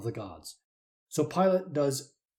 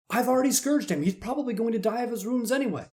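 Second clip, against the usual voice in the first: pleading Pilate; 8 semitones higher than usual. Acted.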